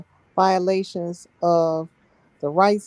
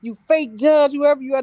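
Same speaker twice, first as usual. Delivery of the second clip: raised, high mock yell at the judge; retold yelling; 6 semitones higher than usual. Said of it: "I'm yelling".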